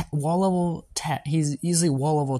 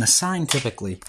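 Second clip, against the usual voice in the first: ordinary talk, nothing marked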